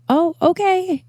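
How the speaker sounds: high doubtful okay; airy disbelieving okay; skeptical nurse. Retold or imitated. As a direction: play the unconvinced nurse.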